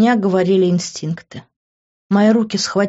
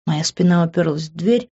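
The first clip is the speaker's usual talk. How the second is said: plain reading voice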